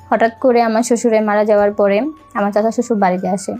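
usual speaking voice

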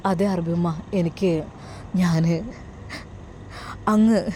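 low, halting, lost for words; tearful. Acted. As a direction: break off between words, choked up. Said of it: "കരയാതെ കാര്യം പറയൂ". (കരച്ചിലടക്കി, വാക്കുകൾ മുറിഞ്ഞ്)

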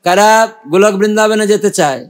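ordinary delivery

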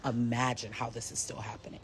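whisper. Real, not acted. Upset rant, dropped to whisper.

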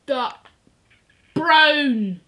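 normal talking voice

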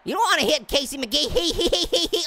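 ordinary talk

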